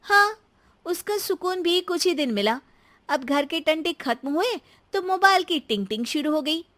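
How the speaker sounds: put-on high, aggrieved young wife; high-voiced wry grievance; grumbling daughter-in-law. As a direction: complain to your husband, high-pitched.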